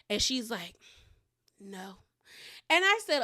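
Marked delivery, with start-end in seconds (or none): 1.6-2.0 much softer, slightly lower; retold quiet no. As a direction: say it small and quiet, imitating her. Retold hesitant answer to question.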